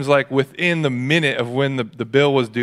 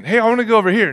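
put-on eager kid; 8 semitones higher, 3 dB louder, much faster than usual. Kid asking God again.

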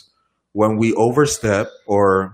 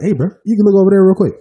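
high, loud and quick heads-up; eager nudge; 10 semitones higher, 8 dB louder, faster than usual. Acted. Speaker tipping off a brother about a sister, eager. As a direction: urge a buddy to look, fast and pitched up.

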